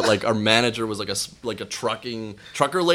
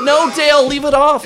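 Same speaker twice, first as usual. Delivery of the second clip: high, loud imitation of admirers; hammed-up chorus of protest; mock cheering crowd; 7 dB louder than usual. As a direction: egg him on, shouting high. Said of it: "everyone's like".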